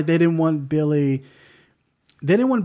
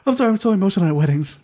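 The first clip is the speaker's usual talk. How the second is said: sheepish excuse; 7 semitones higher, much faster than usual. Retold or imitated. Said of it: "But he's just like".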